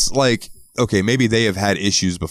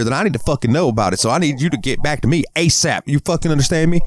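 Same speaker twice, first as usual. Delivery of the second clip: much higher, worked-up husband voice; angry husband; 8 semitones higher than usual. Retold or imitated. Jealous husband on voicemail threatening stranger, higher ranting voice.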